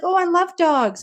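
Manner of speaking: high, gushing dog lover; gushing neighbor. Retold or imitated. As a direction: coo it like you adore dogs.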